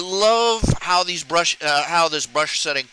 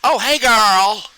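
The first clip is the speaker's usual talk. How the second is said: higher, slow, sing-song camp voice; flamboyant camp greeting; 4 semitones higher, 6 dB louder, slower than usual. Pretend. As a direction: drag out the oh like a sassy friend. Mocking stereotypical flamboyant speech.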